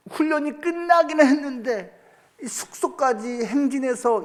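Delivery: high, earnest plea for water; mock-fervent prayer, pitched high; bold prayer. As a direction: pray boldly like a parched soldier. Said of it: "담대하게 제가 속으로".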